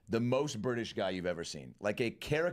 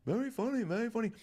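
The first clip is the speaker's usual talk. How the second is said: very funny, much higher and lilting; high amused older man; 13 semitones higher than usual. Retold or imitated. Quoting [name]'s voice.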